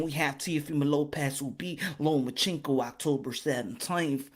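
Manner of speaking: mellow it right down like a TV preacher; calm soft-spoken sermon delivery on boxing; Joel Osteen voice. Acted. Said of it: "I gotta be like Joel Osteen and talk like this".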